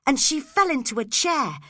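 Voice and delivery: plain reading voice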